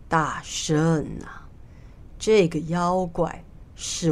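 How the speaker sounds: put-on slow, low, breathy old immortal voice; low drawn-out voice, partly whispered; old immortal, calm plea. A narrator's reading. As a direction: plead unhurriedly as an aged immortal, drawing out each phrase.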